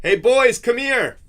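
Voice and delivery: loud call across the house. Real, not acted. Dad calling his boys over, loud.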